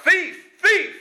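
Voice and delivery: high, loud cry of thief; shouted alarm. A narrator's reading. Attendant wakes and catches the thief, high loud yell. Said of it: "he cries out".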